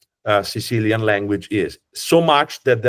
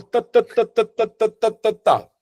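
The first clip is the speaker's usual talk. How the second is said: Sardinian-style rat-a-tat syllables, much higher; rapid-fire syllables; 11 semitones higher than usual. Retold or imitated. Guide mimicking Sardinian rhythm with rapid-fire ta syllables. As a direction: fire off the syllables like a machine gun. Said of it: "like a shotgun".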